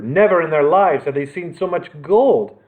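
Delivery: ordinary delivery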